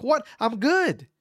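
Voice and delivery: high-pitched incredulous objection; acted-out indignant protest; retold mad protest. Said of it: "but I'm mad".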